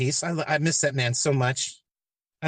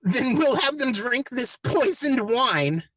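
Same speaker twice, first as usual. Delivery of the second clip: higher, earnest do-gooder voice, half-mocking; mock idealist liberals; 7 semitones higher than usual. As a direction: pitch it up like naive zealots selling a murder plan. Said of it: "a couple of 'em decide".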